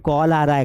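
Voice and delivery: कॉल with rounded "aw" vowel; correct call. Shown as a demonstration. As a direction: say कॉल as "kawl". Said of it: "कॉल नहीं आ रहा है". (कॉल में गोल "ऑ")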